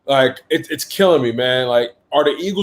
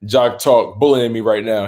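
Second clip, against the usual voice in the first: ordinary talk, nothing marked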